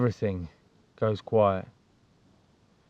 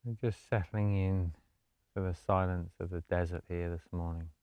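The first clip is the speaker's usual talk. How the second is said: soft, low-key meditation voice with long pauses; guided meditation; 4 semitones lower, 10 dB quieter than usual. Acted. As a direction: gently guide them into stillness, hushed and unhurried.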